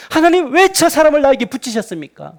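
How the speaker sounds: high, pleading complaint to God; protesting prayer. Acted. Spouse praying to God about the partner, complaining. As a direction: demand to know why, aggrieved.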